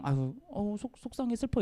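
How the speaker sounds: a hint of a pouting sulk; mock sulky sadness. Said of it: "이래서 갔을까요?". (작고 투정하듯 속상한 척)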